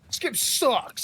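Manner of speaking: slow, high mock whine; whiny gamer. Mocking gamer complaint.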